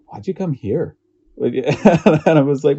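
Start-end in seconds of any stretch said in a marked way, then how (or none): 0.1-1.0 high-pitched puzzled question; mock-incredulous, higher voice; retold bafflement. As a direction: imitate baffled locals, pitched up. Locals' surprise at the visitor.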